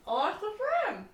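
"I lost the thread" high and laughing. Real, not acted. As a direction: giggle through "I lost the thread".

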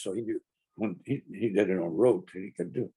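normal talking voice

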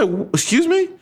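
high, stunned question; incredulous. Retold or imitated. Son repeating his shocked reply to mom, incredulous. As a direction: act floored by the question. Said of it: "I was so annoyed".

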